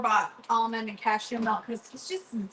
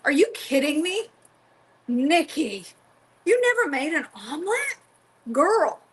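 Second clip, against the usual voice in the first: disbelief; 4 semitones higher than usual. Real, not acted. Shocked friend never made omelet.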